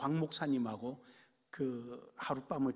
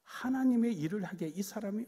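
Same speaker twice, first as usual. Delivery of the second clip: ordinary talk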